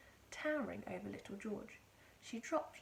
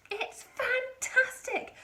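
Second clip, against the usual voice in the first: high, loud, slow exclamation; excited shout; 9 dB louder, much slower than usual. A narrator's reading. Mister Cranky dancing round and shouting at the hen. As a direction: shout it with delight. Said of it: "Mister Cranky shouted".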